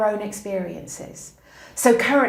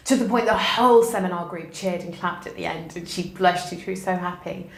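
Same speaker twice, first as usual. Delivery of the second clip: normal talking voice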